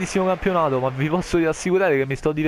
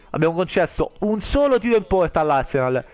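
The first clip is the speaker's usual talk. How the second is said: ordinary talk, nothing marked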